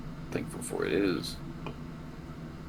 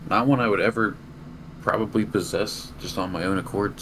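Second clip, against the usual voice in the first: ordinary talk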